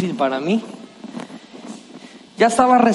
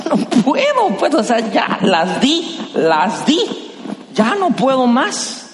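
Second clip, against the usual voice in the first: exhausted complaint; 5 semitones higher, slower than usual. Retold or imitated. Exhausted runner answering crowd.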